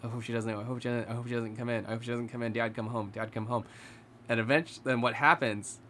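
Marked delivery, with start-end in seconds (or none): 0.0-2.5 scared hushed pleading. Retold or imitated. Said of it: "scared out of his mind". 2.5-3.7 softly begging Dad to come home, a mild retelling; lightly imitated frightened boy murmuring "Dad, come home"; hushed scared plea. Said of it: "scared out of his mind".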